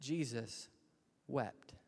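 Jesus, long pause, then wept dropped soft; heavy two-word reading with a long gap; weighty pause. Acted. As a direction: let Jesus hang, then breathe wept. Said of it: "but the weight of it".

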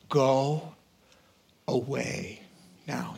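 low, fading, almost whispered; quiet aside. Real, not acted. Preacher echoing the crowd, dropping to a near whisper. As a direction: let it sink in softly. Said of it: "softly repeated".

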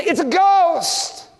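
high, slow mock terror; lightly acted fearful disciple; terrified cry. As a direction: drag it out like you've seen a ghost. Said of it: "these guys say".

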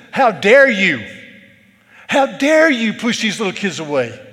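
loud, high, indignant scolding; stern rebuke. Retold or imitated. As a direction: blast the disciples with fierce anger. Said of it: "rebuke means to sternly speak to".

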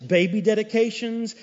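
ordinary talk

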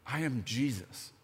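soft, low and solemn; solemn reveal. Acted. Preacher revealing Jesus' answer to Saul, hushed. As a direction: let the name land gently.

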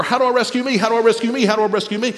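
mock anxious refrain; anxious fixation. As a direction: repeat it like a worried, circling thought. Mimics fixation on self-rescue.

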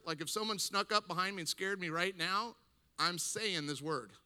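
ordinary talk, nothing marked

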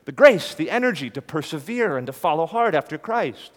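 rising sermon climax; full preaching force. Real, not acted. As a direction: preach it out with conviction. Preacher's climax on living hope.

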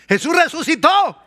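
high, loud joyful proclamation; exultant shout. Real, not acted. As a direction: proclaim it with joy.

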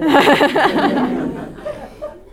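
big loud laugh; loud burst of laughter. Real, not acted. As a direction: roar with laughter. Group laughs at joke.

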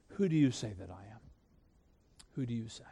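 hushed and quick, pressing the question home; quiet searching question. Retold or imitated. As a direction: ask it softly, as if face to face. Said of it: "which is emphasizing it".

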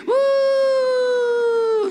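loud, high celebratory whoop; joyful whoop. Acted. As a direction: let out a gleeful high woo. Celebrating grace arriving.

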